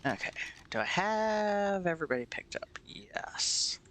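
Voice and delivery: hushed whispered aside. Real, not acted. Checking his game party mid-chat, in a whisper. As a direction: whisper it under your breath, off-mic. Quiet aside while gaming.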